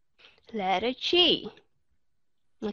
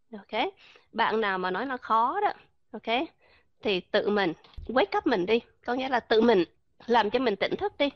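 usual speaking voice

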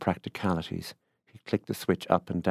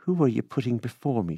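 higher-pitched demand; 5 semitones higher than usual. A narrator's reading. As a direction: demand an answer, with restrained anger. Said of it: "she demanded".

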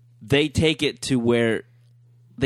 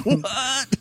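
very high laughing what; shocked what. Retold or imitated. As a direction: squeal it in laughing disbelief. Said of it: "It's like".

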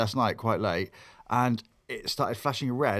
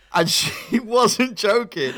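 real laughter through the words, higher and louder; laughing; 10 semitones higher, 8 dB louder, much slower than usual. Real, not acted. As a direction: laugh out the punchline while still telling it. Laughing while retelling funeral.

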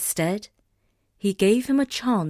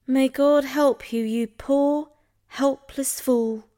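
pitying; 6 semitones higher, slower than usual. A narrator's reading. Sister pities doomed brother.

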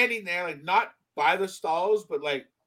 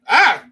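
sudden loud yelp of fright, hammed up; mock startled yelp; 5 semitones higher, 11 dB louder than usual. Spotting a child behind him in the bathroom, mock yelp. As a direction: jump and yelp like you just got spooked.

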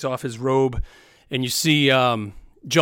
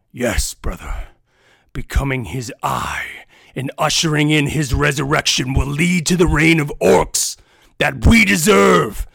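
warlord rising to a shout; 3 semitones higher, 8 dB louder than usual. Acted. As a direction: start cold, end roaring. Villain builds to shout.

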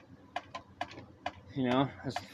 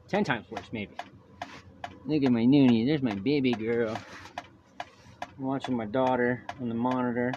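ordinary talk, nothing marked

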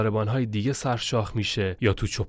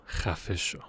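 muttered inward thought; 7 dB quieter, slower than usual. A narrator's reading. Traveller silently curses his guide, quiet inward retort. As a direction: say it softly under your breath. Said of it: "در دلم گفتم".

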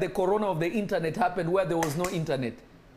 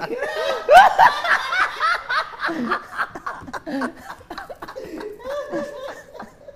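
high laughter; 6 dB louder than usual. Real, not acted. Laughs at own prayer.

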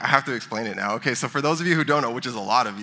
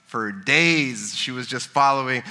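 ordinary talk

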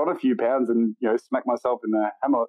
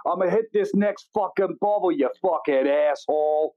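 New York cabbie; 6 semitones higher than usual. Retold or imitated. New York bit continues.

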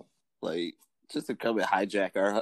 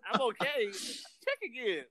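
mock old woman; 14 semitones higher, 7 dB quieter than usual. Voicing the old woman in hospital.

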